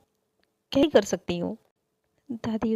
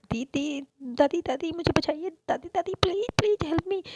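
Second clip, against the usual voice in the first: high, frantic cries for help; panicked pleading; 7 semitones higher than usual. Acted. Boy hiding behind grandma from his sister, high panicked pleading. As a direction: beg grandma to hide you.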